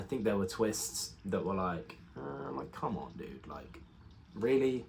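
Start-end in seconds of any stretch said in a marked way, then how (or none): 2.2-4.8 weary disbelief. Retold or imitated. Reacting to weak plot twists.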